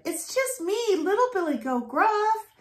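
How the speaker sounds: high, small and timid; tiny squeaky goat; little goat voice. A narrator's reading. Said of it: "little Billy Goat Gruff said".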